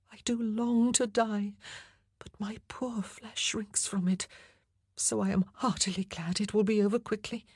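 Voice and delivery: a frightened queen half-whispering her wish to be done. A narrator's reading. Anne voiced, fearful resolve.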